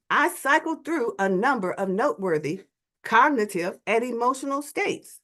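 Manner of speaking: ordinary talk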